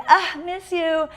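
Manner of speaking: high, slow cooing; lovey-dovey. Retold or imitated. Cooing slowly to his girlfriend on the phone. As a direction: coo it slowly like a smitten boyfriend.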